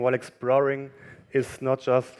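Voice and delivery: ordinary talk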